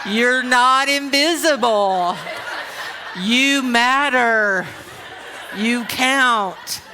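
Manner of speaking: very slow, drawn-out reassurance; exaggerated, emphatic comforting; mock consoling. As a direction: stretch each word out for the overlooked. Mock consoling the overlooked middle children.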